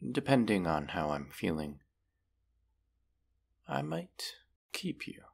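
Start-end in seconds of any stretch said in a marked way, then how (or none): 3.7-5.2 high, soft, sly threat; teasing threat. Acted. Dragon musing to the human about keeping it, sly. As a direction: purr the threat playfully.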